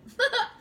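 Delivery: a sudden, high, loud laugh; high burst of laughter. Real, not acted. Laughs at her twin's quip.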